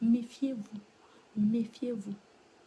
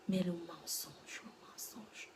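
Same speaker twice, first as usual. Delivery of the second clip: slow, soft, dying into a whisper; hushed, drawn-out aside; fading to whisper; 3 semitones lower, 10 dB quieter, slower than usual. Real, not acted. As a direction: let the repeat vanish into breath. Dismayed aside, fading away.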